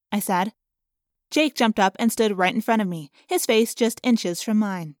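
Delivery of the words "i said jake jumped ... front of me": usual speaking voice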